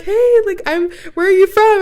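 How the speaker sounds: high, loud imitation of a friendly greeting; mock excited greeting. Friendly man greets her at checkout, mock excited.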